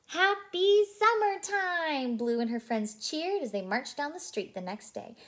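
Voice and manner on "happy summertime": high, slow, loud celebration cry; festive cheer. Acted.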